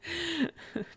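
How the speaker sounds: soft laugh through the denial; amused, bashful laughing denial. Acted. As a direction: laugh it off, quietly. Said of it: "Chifley snorts".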